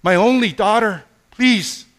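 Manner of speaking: high, loud desperate plea; loud pleading father. Acted. Jairus begging Jesus to heal his daughter, loud desperate plea. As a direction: beg like a father whose child is dying.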